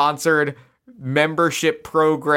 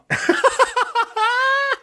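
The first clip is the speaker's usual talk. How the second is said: high shrieking laugh. Real, not acted. Laughing at the expensed bar night.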